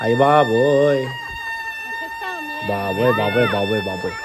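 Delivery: marvel, then let it fade away; amazed fading exclamation. Real, not acted. Reacting to the screaming girl. (అమ్మాయి అరుపు విని ఆశ్చర్యంగా, మెల్లగా తగ్గుతూ)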